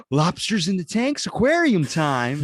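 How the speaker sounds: slow, high, drawn-out mock delight; hammed-up childlike excitement; excited kid. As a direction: play a thrilled child, stretching the words. Acts out self as thrilled kid.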